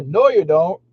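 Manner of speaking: amused, dismissive comeback; laughing retort. Real, not acted. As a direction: chuckle and shoot it down. Laughing retort to imagined child.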